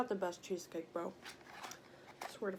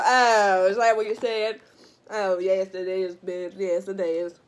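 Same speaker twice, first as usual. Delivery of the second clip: loud country-accent mimicry; mock their loud reaction in a country accent; imitating country folk; 10 dB louder than usual. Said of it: "until I said it in a country accent".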